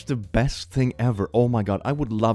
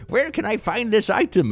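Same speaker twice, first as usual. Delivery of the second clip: mock demanding customer; 11 semitones higher than usual. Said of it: "goes like".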